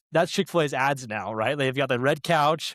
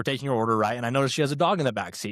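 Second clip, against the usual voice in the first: usual speaking voice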